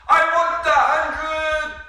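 high, loud, stretched-out yell; shouted wish. Acted. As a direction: blurt it out at full volume, drawn out. Shu blurts out his wish.

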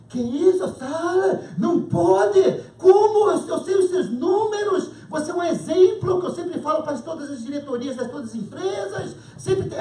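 high, loud disbelieving boss voice; stunned boss. Retold or imitated. Boss pleading with his supervisor not to quit, high and loud. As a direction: play the boss who can't believe it.

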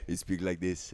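put-on low voice with an Israeli accent; deep Israeli accent. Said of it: "crazy deep Israeli voice".

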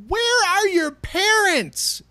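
very high, slow, loud cry of disbelief; exasperated old man. Retold or imitated. Old player shouting at kids online, high and slow. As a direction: wail it like a fed-up old gamer. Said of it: "I would just go".

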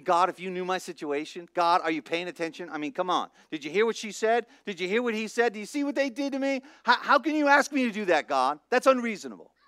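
high, whiny, indignant protest; mock-complain to God about the unfairness.

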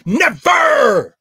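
sudden loud high shout on never; loud cry. Retold or imitated. Old man tells the crowd he never stopped, shouting. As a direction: cry the word out at full voice. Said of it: "Let me take my shoes off".

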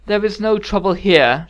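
slow, loud royal declaration with nerves underneath; high, quavering attempt at command. A narrator's reading. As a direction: assert authority while unsteady. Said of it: "she said, voice quavering".

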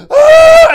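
sharp gasp into a high, loud scream; hammed-up shriek after a gasp; acted scream. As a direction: cry out suddenly at full volume. Acted cry of newborn Beyonder.

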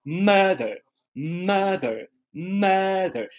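"mother" with stressed open first vowel, weak uh after; carrot then schwa. Shown as a demonstration. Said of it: "A palavra mãe tem os dois fonemas".

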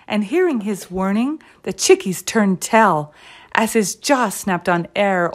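ordinary delivery

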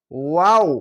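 ওয়াও with a held, gliding vowel; drawn-out waw; stretched letter. Shown as a demonstration. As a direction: draw out ওয়াও, swelling at the end. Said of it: "তিন আলিফ টেনে পড়বেন".

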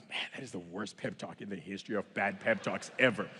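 low, soft, unimpressed aside; understated comic disgust; deadpan dismay. Acted. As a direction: complain under the breath about a useless pep talk. Acted deadpan verdict on pep talk.

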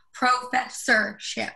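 "professorship" drawn out slowly; careful enunciation. Acted. Professor retrying a fumbled word with mouth full, slow "professorship". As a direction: sound out "professorship" syllable by syllable.

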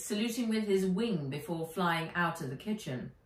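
usual speaking voice

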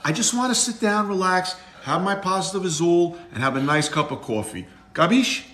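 lightly played New York Italian accent; a hint of a Brooklyn tough guy. Dad doing his New York accent for son. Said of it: "loves your New York accent".

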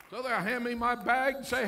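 ordinary talk, nothing marked

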